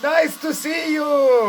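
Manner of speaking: high, slow foreign salesman voice; hammed-up eager car dealer; car rental guy. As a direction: greet them like an overeager rental man. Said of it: "said to us".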